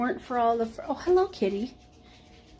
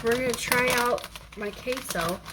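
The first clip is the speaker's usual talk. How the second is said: normal talking voice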